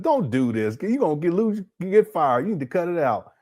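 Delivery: usual speaking voice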